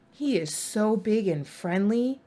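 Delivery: ordinary delivery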